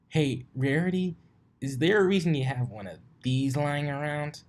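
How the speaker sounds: put-on a brash young pegasus calling out; Rainbow Dash voice.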